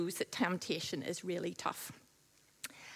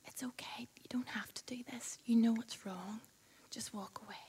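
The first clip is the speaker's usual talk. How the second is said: much softer gentle whisper; Holy Spirit's whisper; 9 dB quieter than usual. Acted. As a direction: whisper it as a reassuring inner voice. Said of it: "the Holy Spirit's saying to us".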